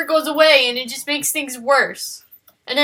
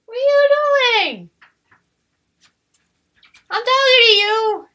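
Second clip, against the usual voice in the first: startled yell; 10 semitones higher, 5 dB louder than usual. Real, not acted. Yelling at pet in drawing.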